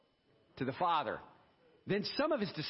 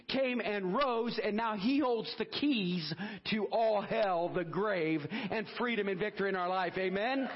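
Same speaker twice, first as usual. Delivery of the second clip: raised, driving preacher's cry building through the list; full-force preaching; 4 semitones higher than usual. Acted. Pastor rousing the congregation about Jesus's victory, preaching at full force. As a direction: preach it out to the whole room.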